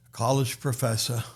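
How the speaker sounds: usual speaking voice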